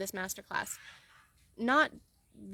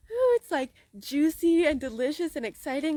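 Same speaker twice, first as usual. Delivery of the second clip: thrilled; 5 semitones higher, 5 dB louder than usual. Retold or imitated. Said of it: "you're like".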